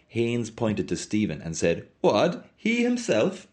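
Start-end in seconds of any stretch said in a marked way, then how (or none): 2.0-3.5 high-pitched baffled questioning; baffled outburst, pitched way up. A narrator's reading. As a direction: echo it in high, puzzled disbelief.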